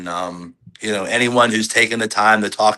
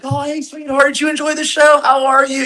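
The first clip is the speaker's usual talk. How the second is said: much higher, sing-song sweet dad voice; dad mode. Retold or imitated. Wrestler greeting a young fan after the show, sweet dad mode. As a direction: coo at the child like a doting father. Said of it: "dad mode".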